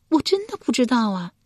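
high-pitched wifely protest; high character voice. A narrator's reading. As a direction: insist as the bewildered wife. Wife protests she does not know.

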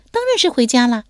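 put-on high, lively girlish voice; young woman's voice. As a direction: play the bold young lady teasing him.

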